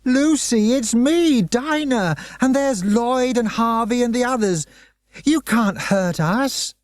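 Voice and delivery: high, pleading call across the room; desperate yell. Acted. Dinah yelling to her hypnotized friend, high and desperate. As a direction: reach her through the trance, yelling high. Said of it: "Dinah began to yell at her".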